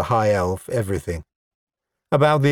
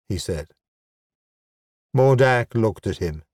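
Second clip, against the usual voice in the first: ordinary delivery, nothing marked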